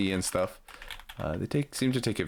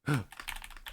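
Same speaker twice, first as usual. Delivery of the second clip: quick breathless gasp; sudden startled gasp; sharp gasp; 8 semitones higher, 7 dB quieter than usual. Real, not acted. Tetris piece goes wrong.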